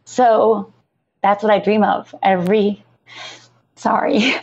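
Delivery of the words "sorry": a choked, breathy whispered sorry through tears; tearful whisper. Real, not acted. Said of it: "I'm crying and I'm sorry".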